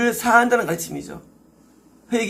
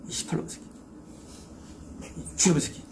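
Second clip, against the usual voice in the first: hushed mock cursing at a demon; muttered swearing at the devil, played for laughs; whispered curse; 3 semitones lower, 10 dB quieter than usual. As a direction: hiss a half-joking curse under the breath. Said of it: "아침에 욕을 막 박아줬거든요".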